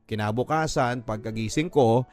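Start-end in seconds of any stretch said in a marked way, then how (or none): none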